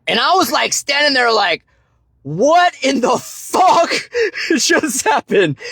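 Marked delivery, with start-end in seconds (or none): 2.2-5.6 high, slow, laughing disbelief; stunned disbelief. Retold or imitated. Stunned man recalling being lifted by a stranger, high, slow disbelief. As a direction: drag it out, utterly stunned and cracking up.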